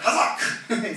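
Hazak shouted with a laugh; excited exclamation. Shown as a demonstration. Pastor to congregation, a gleeful Hazak with a laugh. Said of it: "I love that".

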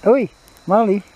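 loud high call to the dog; sharp high call across the garden. Real, not acted. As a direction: shout the dog's name to get him. Calling dog.

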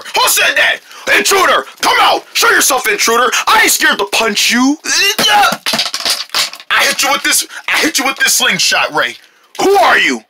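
high, shouted challenge to an intruder; alarmed challenge. Acted. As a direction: shout threats at an unseen intruder.

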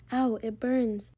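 plain reading voice

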